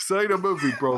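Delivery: hammed-up British "oi, bro" scolding; mock British accent. Host butts into the Peppa bit, mock British lad. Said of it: "I can't do the accent".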